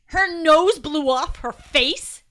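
slow, astonished question; can't believe it, loud and slow; bewildered. Acted.

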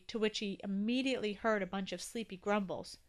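usual speaking voice

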